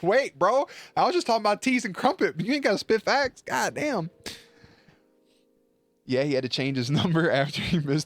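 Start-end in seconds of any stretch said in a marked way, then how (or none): 0.0-4.1 hammed-up hurt reply, pitched way up; mock protest. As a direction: protest in a silly raised voice. Acts out wounded reply to roast.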